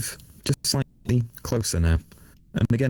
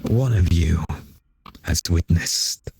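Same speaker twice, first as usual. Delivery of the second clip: slow, tempting otherworldly voice; swarm queen voice; slower than usual. Acted. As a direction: offer dark power, coaxing.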